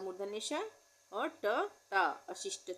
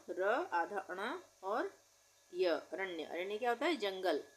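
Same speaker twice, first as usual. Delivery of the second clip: ordinary delivery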